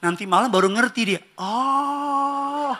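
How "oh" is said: long, drawn-out high "oh"; belated realisation. Acted. Listener finally understands the joke at night, drawn-out mock realisation. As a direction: stretch it out as if finally getting it.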